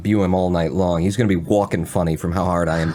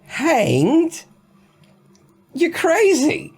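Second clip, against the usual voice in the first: much higher, loud mock-British accent; put-on accent; 4 dB louder, much slower than usual. [name] scoffs at the jailer in an overblown British accent. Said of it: "I'm insulting people with this accent".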